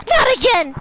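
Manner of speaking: sudden high yell of shock; startled shout. Real, not acted. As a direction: jump out of your skin. Jump scare in game.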